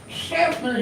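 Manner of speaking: Schaffner high-pitched with a slushy sh start. Shown as a demonstration. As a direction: yelp out Schaffner with a sh at the front. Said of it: "you gotta say it like that".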